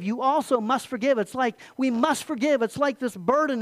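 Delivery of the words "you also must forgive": "also" pushed out as the key word; stress on also. Shown as a demonstration. Pastor contrasting emphasis for the church, leaning on "also". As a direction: lean on "also" in You also must forgive. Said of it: "Do we emphasize".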